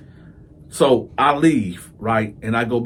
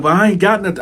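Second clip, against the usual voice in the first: pleading protest; 6 semitones higher, 5 dB louder, faster than usual. Retold or imitated. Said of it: "He was like".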